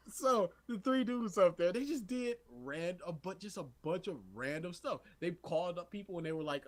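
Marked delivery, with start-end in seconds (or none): none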